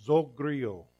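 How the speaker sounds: zogrio said slowly as zoh-GREE-oh; Greek word zogrio. Shown as a demonstration. Pastor teaching the congregation a Greek word, careful pronunciation. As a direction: pronounce zogrio clearly, syllable by syllable. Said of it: "is called zogrio".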